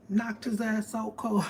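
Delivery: ordinary talk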